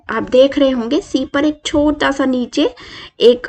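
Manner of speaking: ordinary talk, nothing marked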